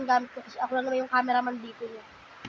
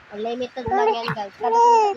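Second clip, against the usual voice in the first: excited; 9 semitones higher, 6 dB louder than usual. Real, not acted. Excited about baby.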